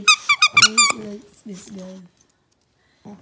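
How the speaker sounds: very loud, very slow complaint; loud drawn-out exasperation. Real, not acted. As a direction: stretch the words out in loud disbelief. Exasperated remark at pet.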